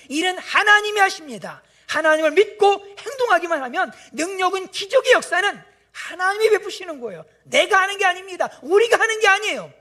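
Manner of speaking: loud, high, fervent sermon delivery; ringing pulpit declaration; forceful preaching. Acted. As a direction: proclaim it to the whole congregation.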